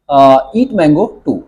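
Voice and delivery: धीरे-धीरे; slow model sentence. Shown as a demonstration. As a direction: dictate slowly. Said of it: "to का भी use करके". (धीमा उदाहरण)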